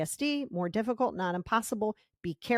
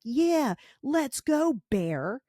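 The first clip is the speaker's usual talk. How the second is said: higher, louder cocky taunt; fired-up challenge; 5 semitones higher, 3 dB louder, much slower than usual. Retold or imitated. Fight response, taunting the bear, cocky and fired up. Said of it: "You're gonna be like".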